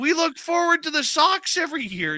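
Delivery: high-pitched mock pleading; exasperated protest. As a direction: protest to mom, voice way up. Kids protesting to mom in story.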